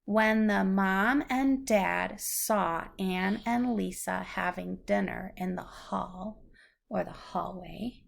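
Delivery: normal talking voice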